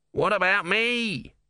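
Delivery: high, slow, whiny little god voice; whining tortoise god. A narrator's reading. Om whining to Brother about being left behind. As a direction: drag out the whine like a spoilt deity. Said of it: "whined Om".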